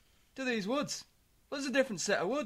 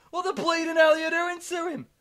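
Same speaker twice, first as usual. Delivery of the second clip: higher and louder outraged demand; hammed-up indignant yell; dad confronts the couple in the car, mock yell; mock angry shout; 7 semitones higher, 8 dB louder than usual.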